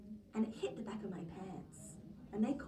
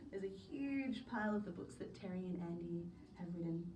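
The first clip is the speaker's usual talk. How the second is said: plain reading voice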